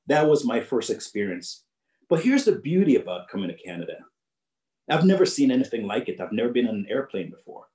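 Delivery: ordinary talk, nothing marked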